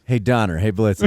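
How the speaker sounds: low, lightly voiced handler; a hint of a handler calling his animals; mock reindeer handler. Imagined handler siccing his reindeer.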